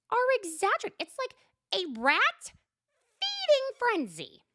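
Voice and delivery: high, loud, furious rant; exasperated outburst. Retold or imitated. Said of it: "Shannon's like".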